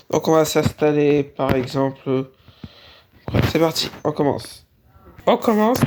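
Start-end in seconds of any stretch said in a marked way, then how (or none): 5.3-5.8 much higher, bright sing-song lift; a sudden high-pitched "let's go"; chirpy restart. Acted. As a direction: pop it up high like a cheerful kickoff. Repeats phrase, second take higher.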